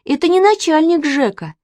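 put-on high-pitched girls' protest; crying out. A narrator's reading. Sisters correct papa's guess, crying out. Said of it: "закричали Катя с Маней".

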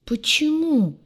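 high-pitched, plaintive protest; upset, high complaint; upset grumble. A narrator's reading. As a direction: protest it like a thwarted man, pitched well up. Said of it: "расстроенно буркнул Урак".

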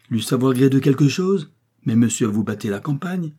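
fed-up shout; exasperated. A narrator's reading. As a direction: burst out in exasperation. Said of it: "s'écria la Quesnel, exaspérée".

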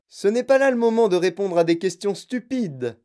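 high, loud, impatient scolding; yelled rebuke. A narrator's reading. Wonka dismissing a child's question, yelling. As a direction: shout the questioner down. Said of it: "hurla Monsieur Wonka".

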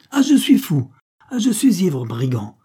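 high, loud indignant exclamations, falling on the last word; worked-up retort. A narrator's reading. Poet-policeman riposting to the captain, over-excited outrage. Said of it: "riposta le poète policier en s'exaltant de plus en plus".

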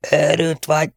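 high, whistling strangled voice; wheezing distorted voice. A narrator's reading. The Tiger scorns his son, wheezing high. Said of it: "sipolta a torszang".

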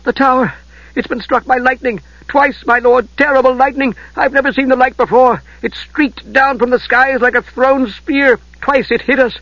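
high, frightened rush of words; breathless awe. Acted. Terrified squire reporting lightning to his lord. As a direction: gasp out the news in terror. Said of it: "could scarcely breathe".

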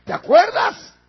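loud, high-pitched challenge; confronting. Acted. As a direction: fire the question out forcefully. Abraham confronts the rich man.

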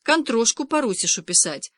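mild narrated whine; whining. A narrator's reading. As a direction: complain with a light moan. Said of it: "заныл Кирюшка".

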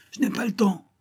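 high-pitched distant cry; high shout without much volume; calling from afar. A narrator's reading. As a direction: call back while running away. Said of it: "il cria".

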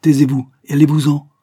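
furious dismissal; offended cry. A narrator's reading. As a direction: drive him away with a shout. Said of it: "s'écria la belle offensée".

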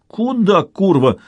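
high outburst, then dropping; alarmed yell without extra volume; mild shout. A narrator's reading. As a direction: cry out to stop him, pitch leaping on the first word. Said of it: "закричал он".

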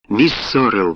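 plain reading voice

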